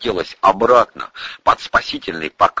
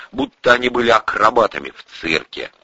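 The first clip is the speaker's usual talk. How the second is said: usual speaking voice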